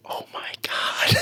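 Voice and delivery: slow whisper, imitating scandalized parents; mock-aghast, drawn-out whisper; stifled shock. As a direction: whisper it while holding back a laugh. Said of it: "they're all trying not to laugh".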